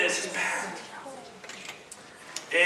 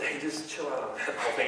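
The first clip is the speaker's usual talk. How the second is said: calm aside; 6 semitones lower, 12 dB quieter than usual. Retold or imitated. Jesus voiced calmly.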